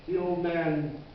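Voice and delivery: ordinary delivery, nothing marked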